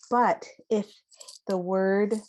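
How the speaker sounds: usual speaking voice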